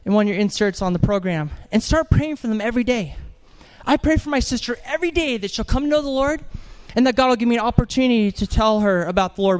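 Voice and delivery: normal talking voice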